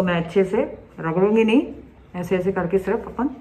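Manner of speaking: muffled behind cloth; talking while patting face dry; speaking through a towel. Real, not acted. Seen 1: Towel pressed over mouth and face while patting dry, mid-speech.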